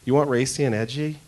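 usual speaking voice

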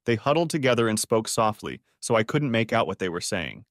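plain reading voice

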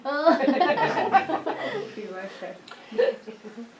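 real laugh, high and loud; high hearty laugh. Real, not acted. Preacher laughing telling the ticket story, hearty laugh. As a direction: burst out laughing at the memory.

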